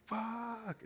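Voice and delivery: high-pitched cry of frustration; mock howl of anger without full volume; retold anger. Boyfriend punching a hill, retold high frustrated outburst. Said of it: "I was so fucking angry".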